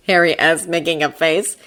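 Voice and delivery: ordinary delivery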